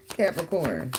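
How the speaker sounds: ordinary talk, nothing marked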